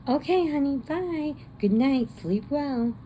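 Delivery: high, sing-song mother; sweet mom voice. Retold or imitated. Mom telling daughter to get off laptop, high sweet voice. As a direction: coo it like a doting mom.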